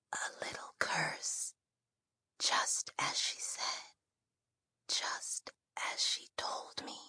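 slow, soft dying whisper. Acted. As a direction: barely breathe the words out. Dying girl keeps whispering.

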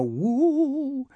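high, drawn-out ghost wail; ghostly woo. A narrator's reading. Narrator imitating the absent ghost, high wailing woo. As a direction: hoot it like a storybook ghost.

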